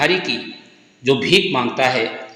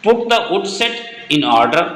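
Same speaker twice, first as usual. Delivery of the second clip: slow dictation; much slower than usual. Shown as a demonstration. Teacher dictates answer slowly.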